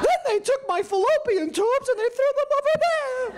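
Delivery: high, delighted voice through laughter; giddy wife. Retold or imitated. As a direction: play the wife thrilled by the mess. Wife describing surgery, quoted.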